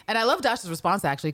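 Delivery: ordinary talk, nothing marked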